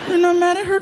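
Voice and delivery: lightly voiced anxious kid; small kid voice. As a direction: ask it like a worried child.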